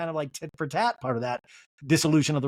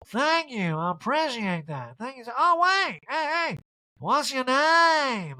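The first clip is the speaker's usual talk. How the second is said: very high, slow, dazed diva calling out; Erica impression; 9 semitones higher, slower than usual. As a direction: drag out a lost, high calling voice. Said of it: "And Justin's like".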